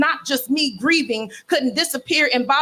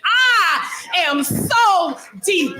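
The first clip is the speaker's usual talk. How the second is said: higher, slow, shouted climax; mock profound shout; 7 semitones higher, much slower than usual. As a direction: belt the refrain as a finale. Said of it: "in this tone of voice".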